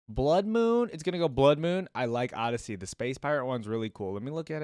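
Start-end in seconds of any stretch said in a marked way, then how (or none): 0.1-1.9 high and loud decisive call; excited pick. Real, not acted. Streamer naming his top skin for viewers, excited. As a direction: announce the winner with gusto.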